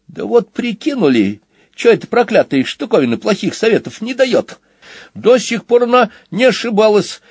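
high-pitched slangy youngster voice; cheeky young twin. Acted. Twin explains hiding under the table, cheeky. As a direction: explain yourself in a high kid's voice.

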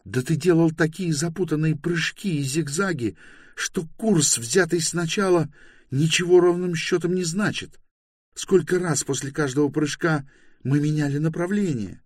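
high-pitched woman arguing heatedly; indignant Bayta. A narrator's reading. Bayta disputing that anyone tracked them, indignant. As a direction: argue back in a high, exasperated female voice. Голос возмущённой Байты.

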